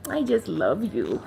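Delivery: normal talking voice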